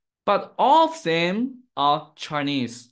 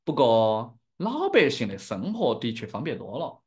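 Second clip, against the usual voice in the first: Chengdu-accented Sichuanese Mandarin; demonstrating a Sichuan dialect accent. Shown as a demonstration. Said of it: "speaking in my dialect".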